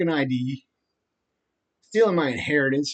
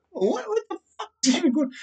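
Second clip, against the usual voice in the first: much higher, strained voice of utter disbelief; incredulous squeak; 4 dB quieter, faster than usual. Pretend. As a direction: squeal it like you can't believe it. Disbelief at sixty hitmen.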